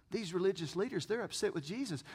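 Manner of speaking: usual speaking voice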